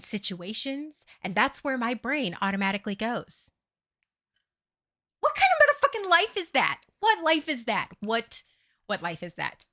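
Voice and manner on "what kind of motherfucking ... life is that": high, loud indignant demand; real exasperation, pitched way up; exasperated outburst. Real, not acted. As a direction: burst out at your own absurd life. Outburst at her own way of living.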